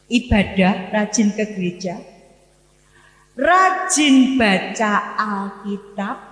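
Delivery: ordinary talk, nothing marked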